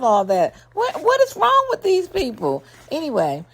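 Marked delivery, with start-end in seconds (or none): 0.7-2.6 high, incredulous what-is-wrong; exasperated outcry. Real, not acted. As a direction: cry out in disbelief at them. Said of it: "trying to not be so animated".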